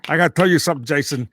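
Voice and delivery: put-on New York wise-guy grievance; Robert De Niro. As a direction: gripe like a mob-movie star. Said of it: "you gotta do your De Niro".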